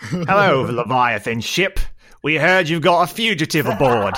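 put-on New Zealand accent. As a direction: greet the ship in a strange New Zealand accent. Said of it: "some strange New Zealand accent".

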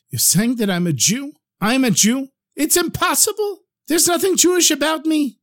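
rising to a high-pitched, baffled protest; incredulous disbelief. Acted. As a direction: repeat it in mounting disbelief, pitch climbing.

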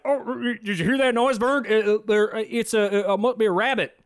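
hammed-up nervous Ernest impression. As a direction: stammer like a nervous goofball making excuses. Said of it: "And he's like".

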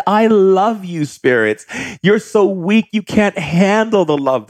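high taunting voice. Retold or imitated. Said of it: "I said".

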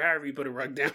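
ordinary delivery

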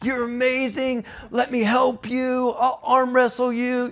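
man hyping up a friend, hammed-up bro encouragement; bro hype. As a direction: hype a buddy up like a gym bro. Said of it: "the tough side".

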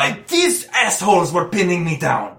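high, loud put-on voice; indignant injured man. Injured man explains to his rescuers, high and indignant. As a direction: protest loudly in a high character voice. Plays injured dog owner.

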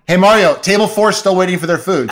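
mock irritated kitchen shout; exasperated manager. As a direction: boss yelling at a dawdling cook. Manager yelling at the chef.

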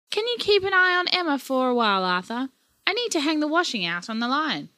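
a hint of a busy nana asking her husband; Nana Sandra's voice.

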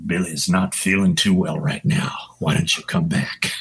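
whispered, low and hushed. Retold or imitated. Drinking buddy whispering through the cracked door. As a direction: breathe it like a secret at the door. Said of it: "And he goes".

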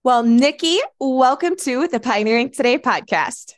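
high, loud, eager greeting; excited. Real, not acted. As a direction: welcome her with real excitement. Host greets guest excitedly.

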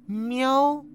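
high, caught-out meow; a baffled little meow; 惊愕的喵; startled meow. Acted. Cat stunned.

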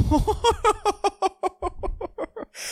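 long, high cackling laugh; real laughing fit. Real, not acted. Comic breaking up after his own punchline, high cackle. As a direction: crack up helplessly at your own joke.

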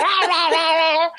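high-pitched garbled yelling imitation; mock shouting. Imitating elderly locals shouting back at a language learner, mock shouting. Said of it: "they shouted like".

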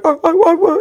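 high, cracking stammer of panic. Retold or imitated. Cornered Bob answering the host, cracking high voice. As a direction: let the voice break upward in fright. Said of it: "the voice cracked".

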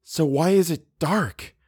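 high, anxious inner voice; frightened questioning. A narrator's reading. Eleanor trying to reason in the darkness, frightened. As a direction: plead to understand, pitched high.

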